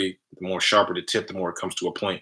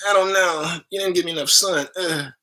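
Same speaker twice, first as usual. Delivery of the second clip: high, louder sulky plant complaint; squeaky plant grumbling, much higher and louder; whiny plant voice; 10 semitones higher, 5 dB louder than usual. Retold or imitated. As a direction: play the neglected plant whining back. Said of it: "He's like".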